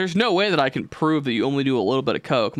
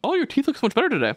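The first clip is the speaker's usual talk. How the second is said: high, fast sing-song fake niceness; hammed-up sugary praise; mocking compliment; 11 semitones higher, faster than usual. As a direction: mimic a syrupy compliment, pitched way up. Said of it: "They'll just be like".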